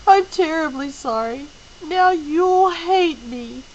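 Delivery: high, slowing into a tearful plea; still sobbing. Acted. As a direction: let the tears take over the words. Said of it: "she sobbed finally".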